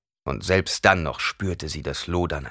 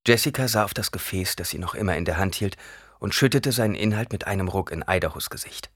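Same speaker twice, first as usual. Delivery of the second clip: ordinary delivery, nothing marked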